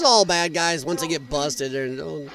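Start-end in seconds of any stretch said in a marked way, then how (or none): none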